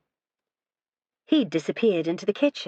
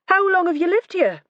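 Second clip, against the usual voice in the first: much higher, louder question; sharp question; 13 semitones higher, 5 dB louder than usual. A narrator's reading. Detective questioning the wife, higher and louder. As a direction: snap the question out. Vera asks, Lorraine answers.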